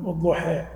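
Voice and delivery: والضحى with final a bent to "eh"; imala. Shown as a demonstration. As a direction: say والضحى as "wad-duheh". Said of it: "بعض العرب يميلون ال". («والضحى» بإمالة الألف المقصورة)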